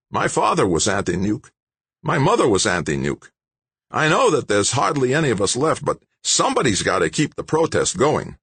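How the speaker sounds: a hint of a keen, lively protester; Don's voice. Don voiced by narrator.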